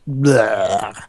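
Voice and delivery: drawn-out noise of distaste; disgusted bleh. Real, not acted. Consumer put off by having to create, disgusted groan. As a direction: let out a dragged, weary bleh.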